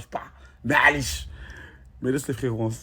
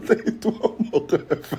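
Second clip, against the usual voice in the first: laughing it out; 3 semitones higher, 7 dB quieter, slower than usual. Real, not acted. Laughing while telling him to leave.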